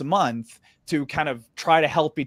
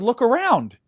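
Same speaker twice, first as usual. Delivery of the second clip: emphatic retort; 10 semitones higher, 5 dB louder than usual. Retold or imitated. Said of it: "I said".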